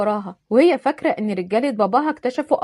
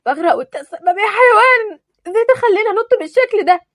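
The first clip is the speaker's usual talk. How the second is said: much higher, louder, panicked blame; panicked scolding; 10 semitones higher, 5 dB louder than usual. Acted. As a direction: scream at him while drowning. Misk panicking in the Nile.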